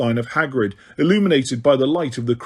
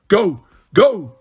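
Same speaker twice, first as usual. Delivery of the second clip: rising, louder commands; shouted order; 4 semitones higher, 4 dB louder than usual. A narrator's reading. Snape ordering the Death Eaters away, shouted command. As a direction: drive them off with a shout. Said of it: "Snape was shouting".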